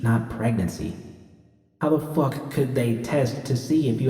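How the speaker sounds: hushed self-mockery; quiet inner voice calling herself a dumbass; self-berating.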